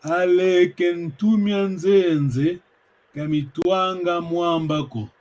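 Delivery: slow, deliberate reading, every word spaced; slow proverb reading. Shown as a demonstration. Teacher reading a proverb aloud to students, slow and deliberate. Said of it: "Alors, je vais lire".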